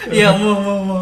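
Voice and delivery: loud, laughing muh muh; laughing moo. Pretend. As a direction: giggle out the moo. Joking moo while laughing.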